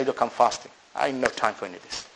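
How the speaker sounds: low, soft, understated brush-off; calm refusal. Acted. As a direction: decline the fight quietly, voice dropped low. Models calm reply to someone wanting fight.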